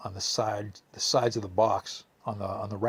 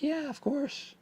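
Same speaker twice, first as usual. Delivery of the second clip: mimicking his mom, much higher and sweet; high mother voice; 3 dB quieter, slower than usual. Imitating [name]'s mom answering the door, high and sweet. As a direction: lift into a high, sugary mom voice. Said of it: "She's like".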